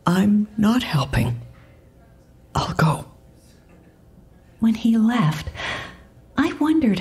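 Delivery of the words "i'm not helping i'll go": resigned, halting admission; understated defeat. A narrator's reading. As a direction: give up and bow out. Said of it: "sounding utterly defeated".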